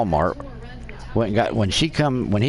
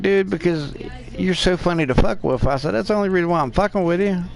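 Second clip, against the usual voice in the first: high-pitched retold teasing; teasing a roommate; 8 semitones higher than usual. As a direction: needle your buddy, pitched up.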